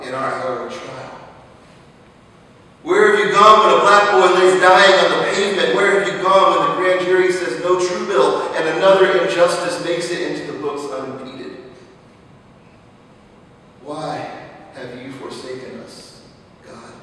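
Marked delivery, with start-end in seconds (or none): 2.8-11.6 loud, high, rising sermon cry; preacher crying out to God over slain children, fiery; fiery preaching. Acted. Preaching at full force. 13.8-16.9 much softer, sunk down, almost spent; preacher asking God quietly after the outcry; soft plea. Acted. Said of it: "soft plea to God".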